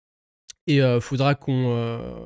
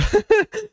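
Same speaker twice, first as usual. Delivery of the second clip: short, high-pitched burst of giggles. Real, not acted. Streamer struggling to answer in English, giggling. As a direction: crack up mid-sentence.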